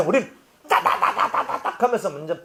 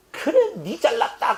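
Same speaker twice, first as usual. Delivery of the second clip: sarcastic retort; 8 semitones higher than usual. Retold or imitated. Mock retort to slanderers.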